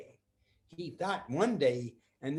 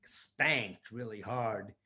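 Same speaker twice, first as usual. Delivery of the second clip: ordinary delivery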